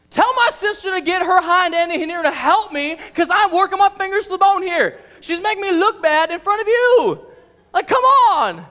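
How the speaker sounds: high, loud, exasperated rant; frustrated. Acted. Martha demanding Jesus send her sister, loud frustrated outburst. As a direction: let loose, completely fed up. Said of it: "how frustrated she is".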